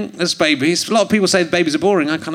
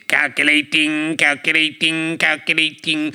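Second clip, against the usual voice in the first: robot voice; 5 semitones lower than usual. Pretend. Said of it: "Robot Voice".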